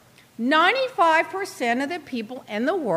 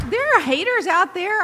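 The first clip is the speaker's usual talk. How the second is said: ordinary delivery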